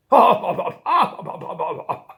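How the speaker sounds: loud, booming cartoon guffaw; mocking dog laugh. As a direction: laugh big in the victim's face. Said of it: "the dog laughed at him".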